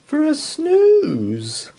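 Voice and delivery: high, loud, slow sleepy sigh; cosy snooze. A narrator's reading. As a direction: stretch it out like a cosy yawn.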